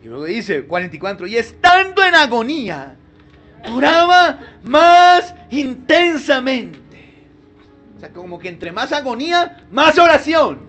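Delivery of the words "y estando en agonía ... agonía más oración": louder, higher, slowed preaching cry; fervent preaching. Acted. Preacher crying out Jesus' prayer to the church, fervent. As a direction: proclaim it with full force.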